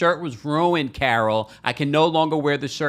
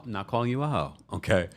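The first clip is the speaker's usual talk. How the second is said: ordinary talk, nothing marked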